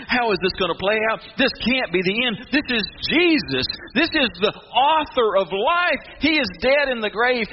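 high, urgent, emphatic voice; anxious disbelief. Acted. Angels wondering all weekend at the grave, urgent disbelief. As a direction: protest that it can't be so.

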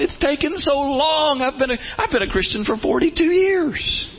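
high mock whine; whiny complaint. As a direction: act out an impatient believer. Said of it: "we get frustrated because we're going".

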